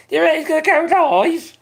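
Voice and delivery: laughing. Real, not acted. Laughing at a chat message.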